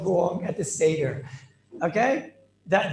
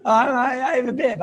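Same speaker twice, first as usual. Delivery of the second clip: loud, high laughing boast; gleeful laughing show-off; laughing proud bib; 6 semitones higher, 7 dB louder than usual. Real, not acted. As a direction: crow about it through laughter. Proudly shows off his bib.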